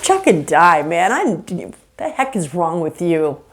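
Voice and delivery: normal talking voice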